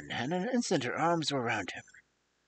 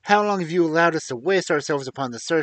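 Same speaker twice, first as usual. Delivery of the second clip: louder, indignant question; angry demand; 4 semitones higher, 10 dB louder than usual. A narrator's reading. Inspector demanding answers from Holmes, angry and loud.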